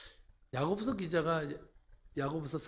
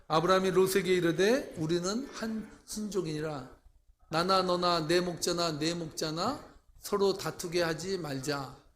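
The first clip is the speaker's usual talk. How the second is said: scripture reading; 5 dB louder than usual. Acted. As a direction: proclaim the verse to the congregation. Reads scripture aloud. (느리고 힘찬 성경 봉독)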